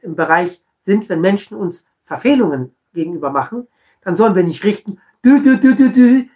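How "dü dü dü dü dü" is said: high loud scolding dü dü; mock reproach, high and loud; finger-wagging tut-tut. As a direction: wag a finger with a sung tut-tut. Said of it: "mit dem Finger".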